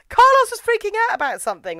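high, loud, fast mock yell; calling for attention. Friends trying to get the priest's attention, calling out. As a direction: imitate an urgent call across a room. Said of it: "trying to get the priest's attention".

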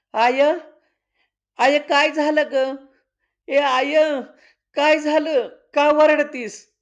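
high, loud, anxious questions to mother; a scared boy woken at night; frightened child. Acted. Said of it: "पोरं घाबरली". (घाबरलेला मुलगा)

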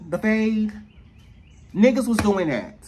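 ordinary delivery

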